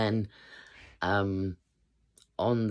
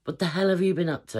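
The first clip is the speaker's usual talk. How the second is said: much higher, louder call up the stairs; dad shouting up the stairs at his daughter, angry; imitating dad shouting; 12 semitones higher, 5 dB louder than usual. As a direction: yell up at your daughter, fed up. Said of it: "my dad's shouting up the stairs".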